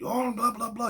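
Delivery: high-pitched blah-blah squabble; mock street cussing. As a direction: imitate a carrying street argument, no real shouting. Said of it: "You can hear 'em four blocks up".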